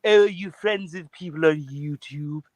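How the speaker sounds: slow, rising, sneering cartoon baby voice; drawn-out snooty baby voice; Stewie voice. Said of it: "That was, that was good".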